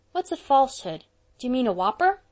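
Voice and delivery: put-on a small boy asking in innocent puzzlement; puzzled boy. Davy to Anne, puzzled by a new word, boyish.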